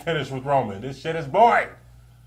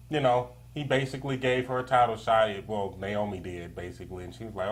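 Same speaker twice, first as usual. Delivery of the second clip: usual speaking voice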